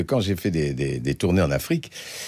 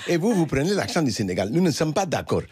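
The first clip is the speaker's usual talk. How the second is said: high-pitched imitation of a Senegalese accent; imitated Senegalese man; 10 semitones higher than usual. Senegalese spectator objects to the comedian's accent, imitated in high Senegalese accent. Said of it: "un Sénégalais qui est venu me trouver".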